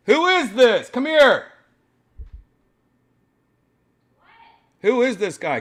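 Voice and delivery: loud, high shouts across the house; calling out loudly. Real, not acted. Streamer shouting for Janice to come see, loud calling. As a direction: yell for her to come over right now.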